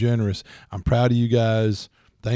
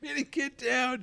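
high, slow telling the dog down; high scolding; 7 dB quieter, slower than usual. Real, not acted. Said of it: "struggling with the puppy".

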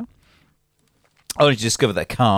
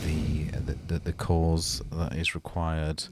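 reverent; 3 semitones lower, 10 dB quieter, slower than usual. Acted. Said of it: "we need to be reverent now".

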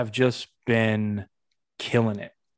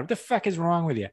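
mock Boston heckler, pitched up; Boston accent; 6 semitones higher than usual. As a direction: jeer in a put-on Boston accent. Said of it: "in a Boston accent".

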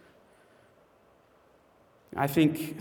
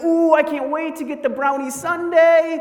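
very high, loud, gushing squeal; over-the-top excited; 12 semitones higher, 9 dB louder than usual. Retold or imitated. Wife told she is getting dessert, hammed-up excitement. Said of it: "she's so excited about it".

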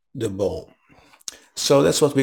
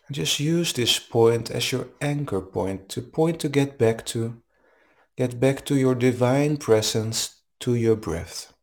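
calm, even meditation guide voice; guided meditation. Acted. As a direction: guide them gently into the breath. Leads a guided breathing meditation.

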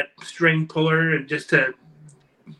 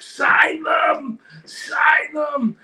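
mock shout, much higher; frantic shout; 13 semitones higher, much slower than usual. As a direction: yell it like a panicked booker grabbing wrestlers. Mocking a frantic booker.

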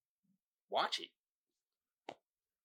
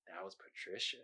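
low, barely voiced reveal; hushed, ominous confession; deadpan creepy punchline; 11 semitones lower, 25 dB quieter than usual. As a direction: let it out very softly, deadpan. Reading the meme's punchline.

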